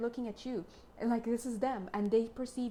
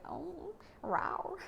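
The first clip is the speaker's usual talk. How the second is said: playful lion roar; 9 semitones higher than usual. Pretend. Lion card voiced roaring playfully.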